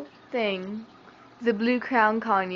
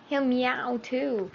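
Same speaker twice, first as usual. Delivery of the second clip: ordinary delivery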